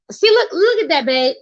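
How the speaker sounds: sudden high call to her partner, look at that; excited call. Real, not acted. Turning to her partner, a sudden excited high call. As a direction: jumps up to point something out. Calls partner over mid-rant.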